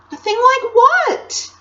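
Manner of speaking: put-on very high, drawn-out girl's question; puzzled question. A narrator's reading. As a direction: ask loudly, pitch high in bafflement. Lucy baffled by confession.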